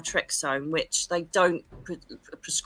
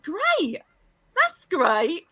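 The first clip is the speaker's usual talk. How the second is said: high-pitched sarcastic "great"; ironic, high and drawn-up enthusiasm; mock delight; 11 semitones higher, 3 dB louder, much slower than usual. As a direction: react to awful news with put-on cheer. Mock reaction to dreadful prophecy.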